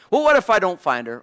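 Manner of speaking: lightly acted fretting, rushed; worried servant. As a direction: blurt out the doubt as the anxious servant. Voicing worried servant asking Abraham.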